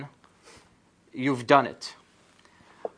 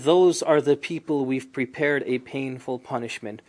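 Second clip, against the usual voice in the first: normal talking voice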